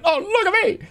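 very high, squeaky comic voice; look-at-me bit. Retold or imitated. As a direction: go way up high for a silly catchphrase. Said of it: "I did the look at me thing".

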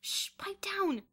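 high, loud, slow hush; hushing order. A narrator's reading. Bruno silencing Boots, hushing order. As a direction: order a friend to shut up. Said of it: "ordered Bruno".

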